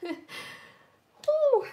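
a high, loud, strangled sob breaking out; sudden sob. Real, not acted. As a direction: let the sob burst out of you unplanned. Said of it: "I didn't expect to cry".

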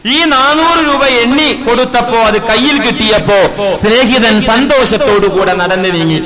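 loud, ringing sermon voice; preaching at full force. Acted. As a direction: proclaim the story to the hall. Loud sermon narration of weeping saint. (ഉച്ചത്തിലുള്ള പ്രസംഗ ശൈലി)

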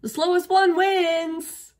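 high, loud, drawn-out call; crying out. A narrator's reading. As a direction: call it out slowly across the race. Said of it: "and he cried out".

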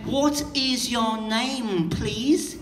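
slow and drawn out, every word spelt out; overly polite, slow and wordy; slow polite version. Retold or imitated. Mocking how you people ask, slow and over-polite. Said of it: "you people will say something like".

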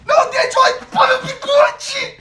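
loud pleading shout through laughter; beg, cracking up; laughing plea. Real, not acted. Begging about losing the bet.

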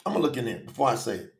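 ordinary delivery

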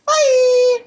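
high, loud chirpy bye; high happy bye. Real, not acted. Vlogger signing off to her fans, chirpy high bye. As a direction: sing out the goodbye. Sign-off to viewers.

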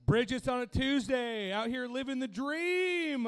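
loud, high, drawn-out stage holler; belted and stretched out, mock triumphant; mock hype shout. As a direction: hype up the room like a showman. Comic hypes up the room on stage.

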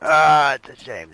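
loud lament. Real, not acted. Player mourning his friend in a loud slow wail. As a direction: draw out the shame loudly.

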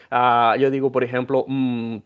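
normal talking voice

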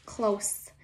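usual speaking voice

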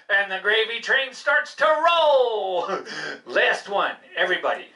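normal talking voice

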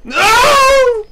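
high cry of dismay; anguished shout. Real, not acted. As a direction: scream No at the screen. Losing the fight, cries out.

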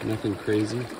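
ordinary talk

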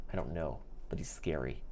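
hushed whisper so he won't hear. Real, not acted. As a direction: whisper it behind your hand. Whispering about man behind.

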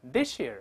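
this year as one word, "thi-sheer"; linked pronunciation. Shown as a demonstration. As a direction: say "this year" with s-y turned to sh. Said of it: "放在一块儿读成".

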